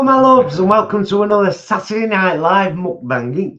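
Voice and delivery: high, loud presenter greeting; loud show intro. Acted. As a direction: belt out the welcome.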